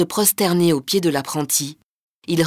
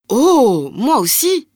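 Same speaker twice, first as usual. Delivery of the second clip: boastful retort; 7 semitones higher, 4 dB louder, slower than usual. Acted. Boastful master interrupts.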